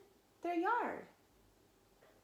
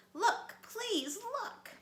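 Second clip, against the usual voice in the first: high, urgent beg; begging; 13 semitones higher, 3 dB louder, much slower than usual. A narrator's reading. Little Wolf begs his parents to look. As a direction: plead desperately. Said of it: "the Little Wolf begged".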